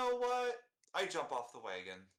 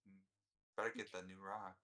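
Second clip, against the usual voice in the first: sulky; 5 semitones lower, 7 dB quieter than usual. Acted. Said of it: "a little spoiled, juvenile".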